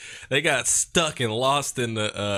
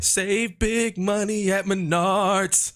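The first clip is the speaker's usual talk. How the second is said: slow ad jingle; 7 semitones higher, slower than usual. Retold or imitated. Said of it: "Number one".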